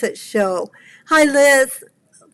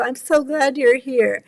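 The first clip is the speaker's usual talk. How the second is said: normal talking voice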